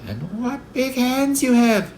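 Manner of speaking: put-on very high, drawn-out child's amazement; high-pitched little-girl wonder; little girl exclaiming. A narrator's reading. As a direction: exclaim slowly as a small girl.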